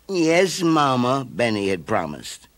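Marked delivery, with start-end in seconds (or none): 0.1-1.2 higher, obedient cub voice; dutiful little bear. A narrator's reading. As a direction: promise sweetly. Child answers mother.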